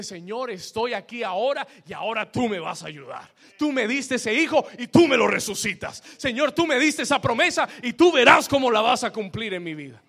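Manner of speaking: loud, declaring prayer that claims the promise; bold prayer. Shown as a demonstration. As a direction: shout it at God with total confidence. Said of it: "Tú tienes que decirle".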